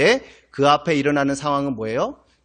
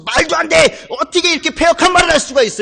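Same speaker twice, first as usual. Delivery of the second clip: high outraged shout; enraged crowd; 11 semitones higher, 4 dB louder than usual. Retold or imitated. Pastor voicing the crowd shouting at Stephen, outraged. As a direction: yell it in disbelief. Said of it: "이렇게 화를 내면서".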